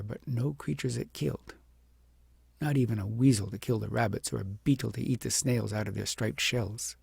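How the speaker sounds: ordinary delivery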